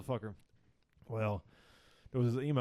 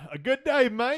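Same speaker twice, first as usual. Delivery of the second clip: mock Australian accent, higher and louder; 10 semitones higher, 6 dB louder, slower than usual. Signing off the podcast in a jokey Australian accent. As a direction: toss off a jokey Australian "good day, mate". Aussie sign-off joke.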